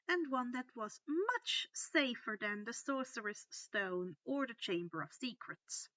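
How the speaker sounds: plain reading voice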